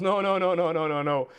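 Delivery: rapid-fire string of mock protests; insistent objection. Norwegian teammate contradicting the Dane in a joke, insistent objection. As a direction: cut in, lightly acting out an objection.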